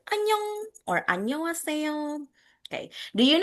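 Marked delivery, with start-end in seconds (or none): 0.1-0.7 high, sing-out Korean hello; imitated Korean greeting. Teacher showing how Koreans say hello, high imitated greeting. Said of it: "the way they greet people". 1.1-2.2 higher, softer Korean hello; imitated Korean greeting. Teacher showing how Koreans greet people, high soft imitation. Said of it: "the way they greet people".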